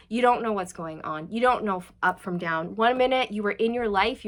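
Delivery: normal talking voice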